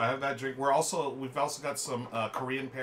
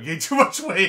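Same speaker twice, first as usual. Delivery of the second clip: laughing; 8 semitones higher, 14 dB louder than usual. Real, not acted. Laughing at own joke.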